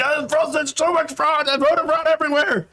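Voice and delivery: high, whining mock Trump; whiny Trump impression. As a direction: whine it like a sore loser. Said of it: "He's like".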